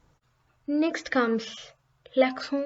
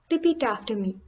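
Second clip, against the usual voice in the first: ordinary talk, nothing marked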